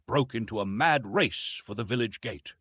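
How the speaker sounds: ordinary delivery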